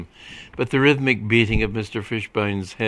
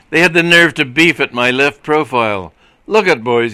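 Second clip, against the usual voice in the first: loud, raised, aggrieved complaint; sour grievance; 5 semitones higher, 8 dB louder than usual. A narrator's reading. Policeman complaining to colleagues about his rejected profile, sourly indignant. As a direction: protest loudly, as if your looks were insulted. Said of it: "eyeing Schnellenheimer sourly".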